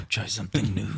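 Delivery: airy whispered repeat; whispered echo. Pretend. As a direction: breathe the words softly with a laugh. Echoing the mock line.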